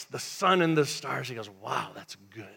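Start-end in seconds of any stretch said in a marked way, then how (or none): 1.5-2.5 low, very soft God voice; quietly marvel, deep down low; hushed admiration. Acted. Voices God admiring his creation.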